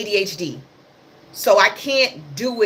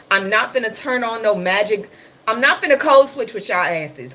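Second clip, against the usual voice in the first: ordinary talk, nothing marked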